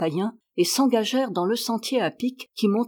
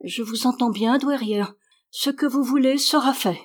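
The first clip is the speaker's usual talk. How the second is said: higher voice, mildly troubled; lightly voiced upset woman, pitched up; altered voice; 4 semitones higher than usual. A narrator's reading. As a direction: submit in a slightly strained voice. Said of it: "une voix altérée".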